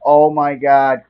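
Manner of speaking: slow, loud disgusted cry; disgusted by smell. Retold or imitated. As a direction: recoil from a bad stink. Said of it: "all of a sudden I hear this".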